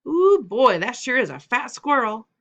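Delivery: loud, high excited yell; little brother's holler. A narrator's reading. Little brother shouting about the fat squirrel, loud excited yell. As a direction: shout it out like the big brother did.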